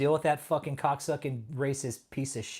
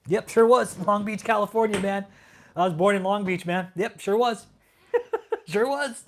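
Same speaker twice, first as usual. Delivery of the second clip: higher, louder sing-song mockery of a chatter's boast; mocking chatter; 6 semitones higher, 6 dB louder than usual. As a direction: parrot his brag back in a mocking whine.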